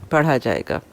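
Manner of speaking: normal talking voice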